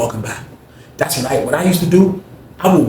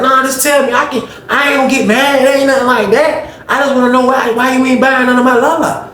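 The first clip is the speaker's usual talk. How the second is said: much higher, sweet wheedling; Greg's high coaxing voice; 11 semitones higher, 3 dB louder than usual. Retold or imitated. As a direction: cellmate begging for the reason. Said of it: "You like".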